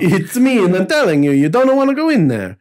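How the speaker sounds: high, hammed-up Italian accent all through the warning. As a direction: play the inner voice as a cartoon Italian. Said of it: "in that Italian voice".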